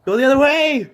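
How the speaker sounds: high, loud urgent shout; panicked shout. Real, not acted. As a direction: yell it at the bird. Shouting at the bird.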